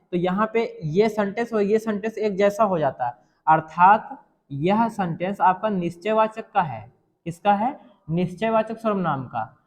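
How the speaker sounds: ordinary delivery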